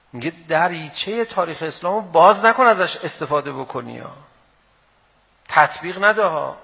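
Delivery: loud, rising rhetorical preaching; preaching at full force. Acted. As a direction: hammer each question at the crowd. Fiery argument to the crowd.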